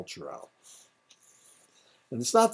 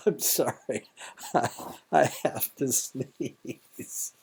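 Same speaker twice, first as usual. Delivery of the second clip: slow, laughing through a held sneeze; 5 semitones higher, much slower than usual. Real, not acted. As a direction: giggle while fighting a sneeze.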